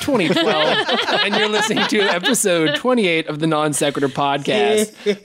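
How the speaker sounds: slow, drawn-out announcer intro; game show host. Acted. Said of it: "like a sports announcer".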